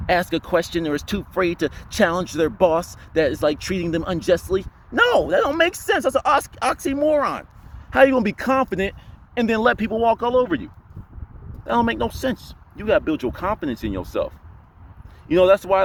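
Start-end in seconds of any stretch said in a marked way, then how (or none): 4.9-12.5 incredulous. Real, not acted. Incredulous rant on timid confidence.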